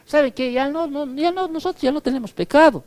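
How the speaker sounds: a hint of a smug false teacher, quick and high; mocking false teacher. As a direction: rattle it off like a smug preacher of lies. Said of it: "A estos que decían". (burla ligera de un falso maestro, rápido y agudo)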